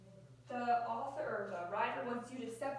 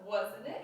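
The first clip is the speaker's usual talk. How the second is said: "Wasn't it" louder, pitch climbing to the end; rising question intonation; 2 semitones lower, 5 dB louder than usual. Shown as a demonstration. Teacher showing a class how a question sounds, "Wasn't it" rising. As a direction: send your voice up at the end of "Wasn't it". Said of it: "Your voice goes up".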